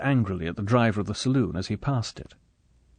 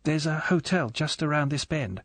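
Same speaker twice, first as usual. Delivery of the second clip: higher, tentative and nervous; 5 semitones higher than usual. A narrator's reading. Scene: Gayler telling Drax where to stop, nervously. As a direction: point it out timidly. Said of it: "said Gayler nervously".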